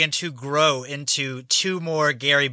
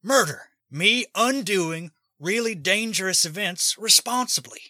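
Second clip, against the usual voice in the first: higher-pitched Busey-ism with a big smile; Gary Busey; 5 semitones higher than usual. Acted. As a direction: deliver it grinning, pitched up in character. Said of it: "with a big smile and he says".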